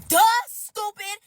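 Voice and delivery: high, slow, loud "duh"; exaggerated are-you-stupid tone; mocking scorn. Mocks the other woman's question.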